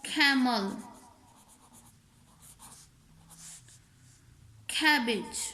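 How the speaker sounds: camel and cabbage opened with Urdu khe; aspirated k. Shown as a demonstration. Teacher giving English examples of the khe rule, aspirated k. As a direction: say camel, cabbage with a strong puff after the k. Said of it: "काफ की आवाज़ से नहीं निकालना".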